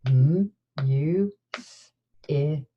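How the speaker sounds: M, U, S, I, K, each sound split apart; sounding out words. Shown as a demonstration. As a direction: segment music into M, U, S, I, K. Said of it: "let's go ahead and sound out music".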